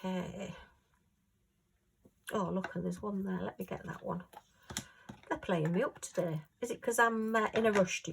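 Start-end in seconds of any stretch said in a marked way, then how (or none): none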